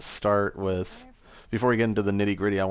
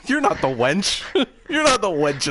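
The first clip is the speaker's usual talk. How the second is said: laughing; 8 semitones higher, 4 dB louder, slower than usual. Retold or imitated. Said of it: "Lee's like".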